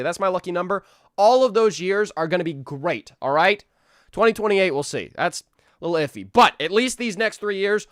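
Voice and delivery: high, loud hyped cheer; loud cheerful rally; excited. Real, not acted. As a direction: pump everyone up for the coming years. Hyped new year wishes.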